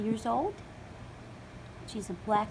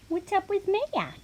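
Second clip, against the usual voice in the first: pet voice; 10 semitones higher than usual. Real, not acted. Greeting her dog.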